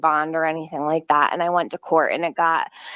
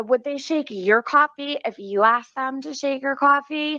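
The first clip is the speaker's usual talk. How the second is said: higher, taunting retold question; mocking retort; 6 semitones higher than usual. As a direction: needle the cop with a pitched-up taunt. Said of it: "I said".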